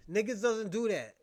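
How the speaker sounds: normal talking voice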